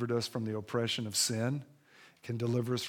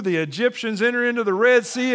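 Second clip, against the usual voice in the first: ordinary delivery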